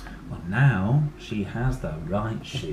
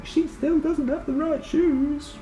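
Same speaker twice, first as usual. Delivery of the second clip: high, slow snooty jibe; mocking girls; slower than usual. Girls mock the dinosaur's shoes, high and snooty. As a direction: sneer like a snooty girl. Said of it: "they're saying".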